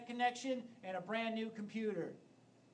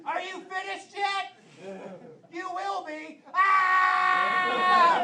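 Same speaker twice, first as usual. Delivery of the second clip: high, slow anime taunt bursting into a loud scream; anime battle scream; 11 semitones higher, 13 dB louder, much slower than usual. Pretend. Comic acting out Dragon Ball Z sex, high taunt into loud anime scream. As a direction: taunt slowly then power up with a yell. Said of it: "mostly me screaming the entire time".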